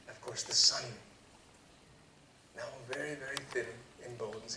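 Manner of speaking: plain reading voice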